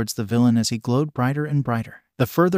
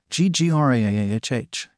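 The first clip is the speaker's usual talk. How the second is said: a strained, guttural scream of pain; pained monster scream. Acted. Gajira burned by the atomic wave, pained monster scream. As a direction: roar out the burning pain like a wounded beast. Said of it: "Gajira screamed at the pain".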